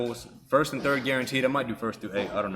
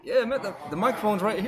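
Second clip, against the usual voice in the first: mock yell; 11 semitones higher than usual. Real, not acted. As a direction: yell it out to prove the mic works. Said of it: "So I gotta yell".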